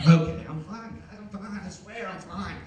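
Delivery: acted nervousness. Nervous moviegoer assuring friends he's okay, mock nervous. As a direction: nervously insist to everyone, hammed up. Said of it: "like, all nervous".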